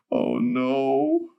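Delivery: put-on very high, scared old woman's whimper; frightened repeating. A narrator's reading. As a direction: cower like a lost child. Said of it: "she kept saying".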